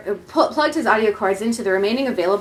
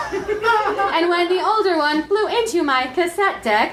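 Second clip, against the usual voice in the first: mock ecstatic reminiscing; 6 semitones higher, slower than usual.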